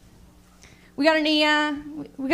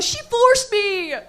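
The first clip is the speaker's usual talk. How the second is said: slow, higher mock excuse, laughing; guy's excuse; 6 semitones higher, 5 dB louder, much slower than usual. Cheating guy blaming the girl, slow whiny mock voice. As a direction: whine the excuse slowly. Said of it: "I am better at doing the male character".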